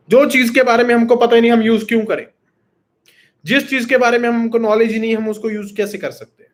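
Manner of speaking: fired-up teacher arguing his case; emphatic, fast rhetorical questions. Real, not acted. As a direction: drive the point home with rapid questions. Rhetorical argument against idioms.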